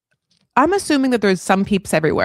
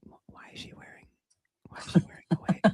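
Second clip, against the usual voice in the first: whispered aside to the guys; 12 dB quieter than usual. Pretend. Wishing the man whispered to his friends about the wig. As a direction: hush it like a secret. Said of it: "I want him to be like".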